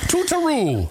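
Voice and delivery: posh farewell. Pretend. Mock Victorian farewell.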